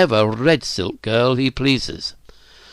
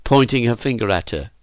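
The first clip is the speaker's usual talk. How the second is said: plain reading voice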